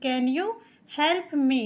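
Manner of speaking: "Can you help me?" spoken very slowly; slow model question, each word clear; slow teaching read. Shown as a demonstration. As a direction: dictation pace for beginners. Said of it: "तो हो गया".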